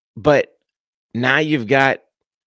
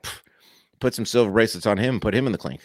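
normal talking voice

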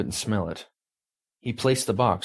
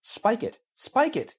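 high urgent yells at the team; yelling. Acted. Carol yelling at the crew to kill the feed. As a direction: shout the order, pitched way up. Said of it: "Carol yelled".

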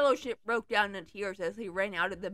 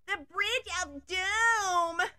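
high, slow dramatic yell; 8 semitones higher, much slower than usual. Acted. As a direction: cry it out like a ditzy heroine. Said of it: "yelled Gwenelle".

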